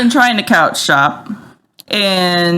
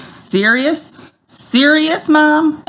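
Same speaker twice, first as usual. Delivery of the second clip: quoting the daughter; 8 semitones higher, much slower than usual. Retold or imitated. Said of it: "she'll say".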